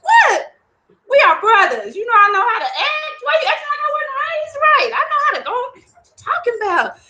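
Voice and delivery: high, indignant protest; Ogun offended. Acted. Ogun protesting to Shango that he knows how to behave, indignant. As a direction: act insulted by your brother. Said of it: "He's like".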